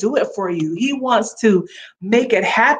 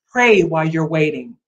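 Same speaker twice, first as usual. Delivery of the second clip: normal talking voice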